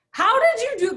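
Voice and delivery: incredulous squeak of a husband; mock baffled husband. Husband asking wife how she paid, high incredulous mock.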